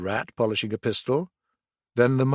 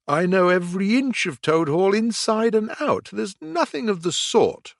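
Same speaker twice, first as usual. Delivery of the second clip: higher, proud toad voice; boastful Toad; 8 semitones higher than usual. A narrator's reading. Toad insisting to Badger, lightly voiced and high. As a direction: brag that you know every inch. Toad's voice.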